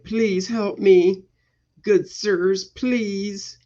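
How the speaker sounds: high, slow begging damsel voice; drawn-out mock damsel plea; pleading damsel.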